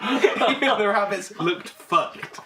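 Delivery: slow, laughing echo of the line; laughing repeat. Retold or imitated. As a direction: savour it through giggles. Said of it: "This is so eloquently".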